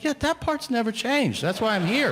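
high, strained exasperation; fed-up customer stating the obvious; exasperated retort. Retold or imitated. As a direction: insist on the obvious, pitch soaring. Exasperated customer answers cashier.

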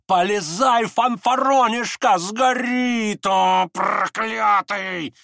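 frenzied, high roaring, words stretched out; Фердыщенко. A narrator's reading. Фердыщенко tugs Ганя's sleeve, frenzied roar. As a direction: roar and curse at him in a frenzy. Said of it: "в решительном исступлении".

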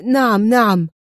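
a hint of panic, quick nervous yes; lightly voiced terrified agreement; panicked cry. A narrator's reading. Alexandrov answering Adham, mild frightened exclamation. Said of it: "أومأ ألكسندروف برأسه في ذعر وهو يهتف".

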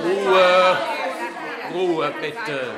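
loud, high barked command; shouted order. Acted. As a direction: silence the hall with one shout. Calls crowd to order.

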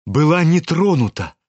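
usual speaking voice

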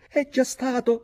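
high, loud indignant outburst; shrill shriek. A narrator's reading. Young narrator interrupts the toast, shrieking. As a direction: shriek it out, jumping up. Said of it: "strillai io".

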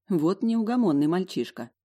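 ordinary delivery

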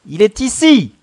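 high, loud accusing yelp. A narrator's reading. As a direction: yelp it out sharply. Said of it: "glapit la Crique". (glapir d'une voix aiguë)

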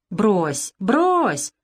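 usual speaking voice

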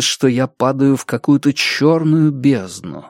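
usual speaking voice

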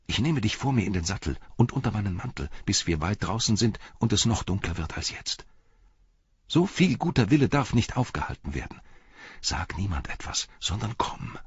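put-on secretive young rider. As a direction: confide the plan, dropping toward a whisper.

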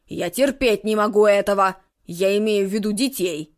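grumpy man voice. Acted. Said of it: "цокнул языком".

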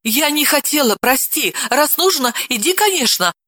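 a high, timid wife's babble; timid wife, pleading. A narrator's reading. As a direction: apologize in a frightened rush. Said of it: "Жена испуганно залепетала".

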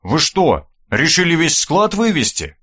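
ordinary delivery, nothing marked

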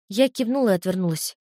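usual speaking voice

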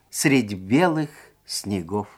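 usual speaking voice

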